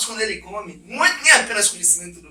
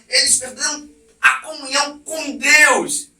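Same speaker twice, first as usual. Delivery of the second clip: ordinary talk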